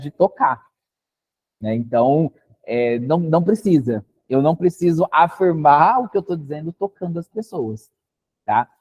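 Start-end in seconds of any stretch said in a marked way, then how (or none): none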